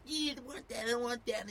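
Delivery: high-pitched mock tantrum of a little brother; imitated high, fussy child; fussing little kid. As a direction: squeal it like a crying child.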